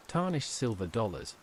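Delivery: plain reading voice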